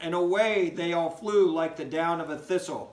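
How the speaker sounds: lively, singsong storybook reading; animated read-aloud for young listeners; reading to children. Acted. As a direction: read it like a bedtime story for kids. Teacher reads poem to children.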